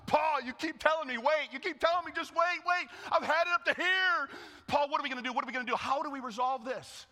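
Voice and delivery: high, loud laughing rant; fed up and cackling through it; laughing, pleading outburst at Paul; exasperated [name]. Acted.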